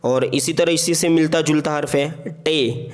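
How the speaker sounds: ordinary talk, nothing marked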